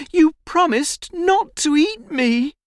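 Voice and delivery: put-on high-pitched, pleading boy; crying out. A narrator's reading. Boy reminds the tiger of his promise, crying out. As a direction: protest in a small, high voice. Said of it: "cries Rahul".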